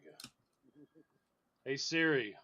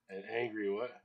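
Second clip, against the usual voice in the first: ordinary talk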